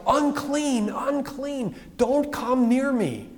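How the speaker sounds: high mock yell of a leper warning the crowd; calling out a warning. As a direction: cry out a warning to keep people away, pitched high. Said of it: "they were gonna yell".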